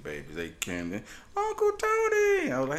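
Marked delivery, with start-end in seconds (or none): 1.4-2.5 put-on high, slow child calling out; squeaky little niece; sweet high kid greeting uncle; little girl voice.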